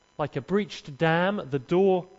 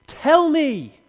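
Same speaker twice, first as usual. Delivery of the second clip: much higher, loud demand; demanding Delilah; 10 semitones higher, 9 dB louder than usual. Acted. Delilah demanding Samson's secret, higher and louder. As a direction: snap it at him like an angry lover.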